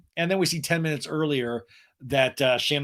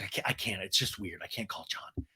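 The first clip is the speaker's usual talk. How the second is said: mock hushed; 7 semitones lower, 11 dB quieter than usual. Shannon voiced, low and hushed.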